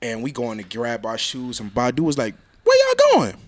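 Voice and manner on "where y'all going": much higher, louder put-on voice. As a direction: call out like a surprised star. Singer voiced calling out.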